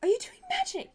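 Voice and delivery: very high, loud outraged question; shocked matron scolding; scandalized healer. Acted. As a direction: catch him red-handed. Healer catches illegal magic.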